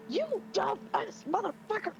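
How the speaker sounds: high mock yell; hammed-up angry insult. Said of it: "Vinesh is just like".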